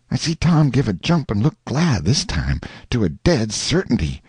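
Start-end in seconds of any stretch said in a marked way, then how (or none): none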